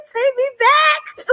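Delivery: high, drawn-out fangirl cry; excited jamming. Retold or imitated. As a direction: soar up high, lost in the music. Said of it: "I'm, like, jamming".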